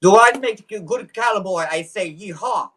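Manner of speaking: high, hammed-up Russian cowboy; comic Russian-accented yeehaw. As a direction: try to play cowboy in a thick Russian accent. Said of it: "Do you want me to say them in Russian".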